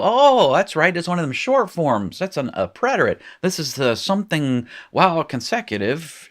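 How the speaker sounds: high-pitched mock lightbulb moment, starting loud; acted sudden realization. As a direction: act out a student suddenly getting it, pitched high. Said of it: "And you go".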